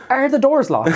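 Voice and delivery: high-pitched retold alarm; mock panic. Passenger asks as the driver comes over, mock panic.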